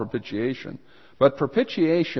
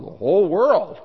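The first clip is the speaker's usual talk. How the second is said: exclaimed; 7 semitones higher, 6 dB louder than usual. Real, not acted. Answers his own rhetorical how.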